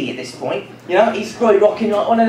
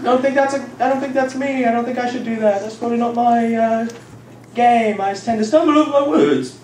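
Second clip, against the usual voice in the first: reluctant Moses; 5 semitones higher than usual. Retold or imitated. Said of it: "Moses goes".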